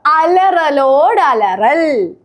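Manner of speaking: very slow, stretched syllables; slow demonstration. Shown as a demonstration. Coaching a friend through the hard phrase, very slowly. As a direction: draw out every syllable for the learner. Repeating twister slowly for learner.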